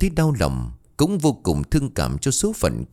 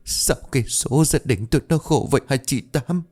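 higher, tearful lament; sobbing; 5 semitones higher than usual. A narrator's reading. Widow lamenting her family's fate to her neighbour, sobbing. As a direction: sob out the question, as narrated grief. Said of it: "Bà nói trong tiếng nấc".

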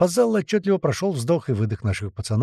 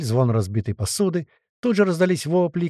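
ordinary delivery, nothing marked

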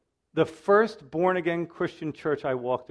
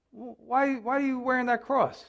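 nosy church lady; 6 semitones higher, 4 dB quieter than usual. Retold or imitated. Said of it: "this lady comes up to me and says".

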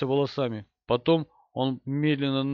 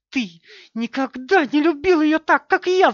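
very high, loud screech of accusation; shrieking; 13 semitones higher, 8 dB louder than usual. A narrator's reading. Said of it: "завизжала она". (истеричный визг)

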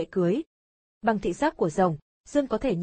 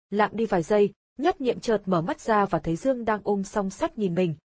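ordinary delivery

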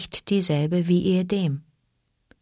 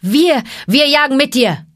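loud, higher eager cry; eager calling; 8 semitones higher, 7 dB louder than usual. Acted. The four wolf cubs calling to Mowgli, loud and eager. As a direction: shout it to join him. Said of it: "riefen die vier Wolfsjungen".

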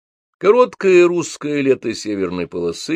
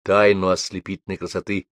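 plain reading voice

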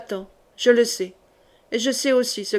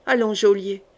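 ordinary delivery, nothing marked

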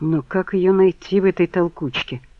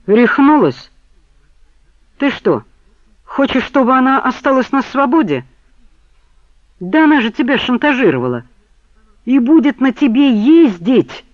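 higher and louder, nearly yelling; almost shouting; 9 semitones higher, 6 dB louder than usual. A narrator's reading. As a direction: barely hold back a full shout. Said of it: "Чуть не заорал он во весь голос".

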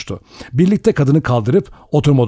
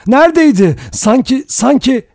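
much higher, loud frightened stammer; panicked; 13 semitones higher, 4 dB louder than usual. A narrator's reading. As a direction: gasp out the words in fright. Vera keeps crying out in panic. (panik içinde kekeleme)

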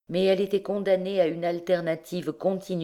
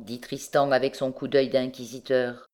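plain reading voice

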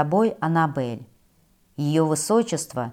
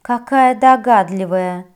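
mocking snort; 3 semitones higher, 6 dB louder than usual. A narrator's reading. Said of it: "хмыкнула принцесса".

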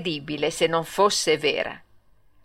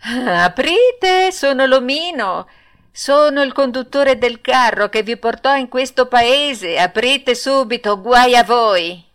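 higher, loud demand from behind the door; the little coachman threatening the boys from outside; 5 semitones higher, 4 dB louder than usual. Acted. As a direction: pound on the door and order them to open. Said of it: "una voce di fuori disse".

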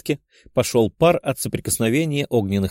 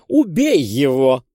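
vicious command; 2 semitones higher, 4 dB louder, slower than usual. Acted. Countess urges killing.

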